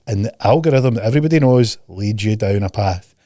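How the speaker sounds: ordinary talk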